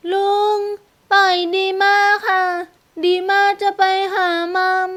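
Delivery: put-on high-pitched small child voice; little girl. A narrator's reading. Girl asking uncle to let her go, child voice. Girl struggles to reach mother.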